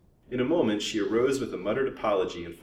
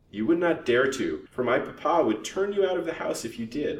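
higher, louder spirited comeback; 7 semitones higher, 5 dB louder than usual. A narrator's reading. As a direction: hit back with spirit. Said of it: "replied the girl spiritedly".

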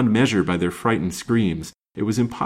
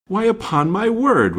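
high, louder astonished exclamation; startled surprise; 12 semitones higher, 5 dB louder than usual. A narrator's reading. Newcomer recognizes his friend, surprised. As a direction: exclaim as you suddenly recognize someone. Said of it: "with a start of surprise".

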